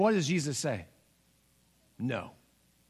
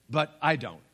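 normal talking voice

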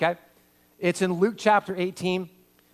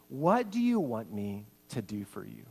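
gentle question; 7 semitones lower, 6 dB quieter than usual. Acted.

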